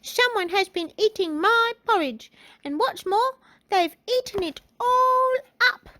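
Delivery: put-on very high, loud baby bear; little baby voice. A narrator's reading. Baby Bear finding his porridge all eaten, high baby voice. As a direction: pipe up like an upset little bear. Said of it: "said in his little baby voice".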